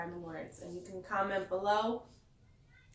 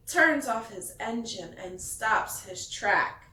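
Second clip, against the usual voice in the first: lively picture-book read-aloud; storyteller reading to kids; reading to children; 5 dB louder than usual. A narrator's reading. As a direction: read it like bedtime story time.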